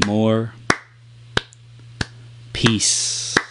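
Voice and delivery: steady chant winding down to slow, soft, spaced words; rhythmic self-calming, fading and slowing; gradually slowing down. Shown as a demonstration. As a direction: wind down into calm with ever longer pauses. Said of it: "to slow yourself down".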